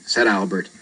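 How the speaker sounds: usual speaking voice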